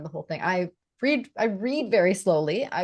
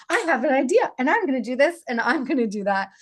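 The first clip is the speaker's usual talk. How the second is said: higher, louder retold eureka; excited burst of ideas; 5 semitones higher, 4 dB louder than usual. Host retells her first reading to the author, excited. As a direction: re-enact a sudden rush of inspiration, easing off.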